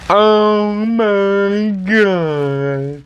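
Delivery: long drawn-out, awestruck exclamation; overwhelmed awe. Real, not acted. Fan marvelling at the new package, overwhelmed. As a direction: stretch every word out in amazement.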